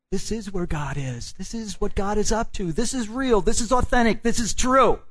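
from level to high and loud; preaching, building. Acted. Preacher affirming truth to congregation, rising to loud. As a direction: climb with each "This is".

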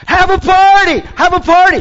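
high, loud joyful shout; exultant call to celebrate; party shout. Acted. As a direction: cheer it like throwing a party. Preacher voicing the woman's celebration.

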